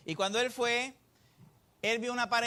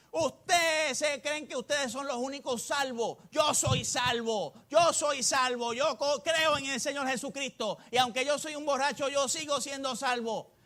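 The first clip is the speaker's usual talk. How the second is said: put-on higher, louder, slow, insistent drunk; mock drunk boast; 6 semitones higher, 4 dB louder than usual. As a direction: ham up a tipsy man insisting he's saved. Preacher voices drunk man in story.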